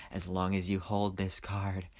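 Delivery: a hint of Joker menace; quoting Joker quietly to the audience; bad Joker impression. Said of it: "that was probably a bad impression".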